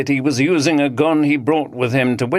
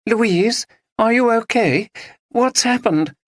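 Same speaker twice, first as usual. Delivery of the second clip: anxious; 13 semitones higher than usual. Acted. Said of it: "her mother's anxious voice".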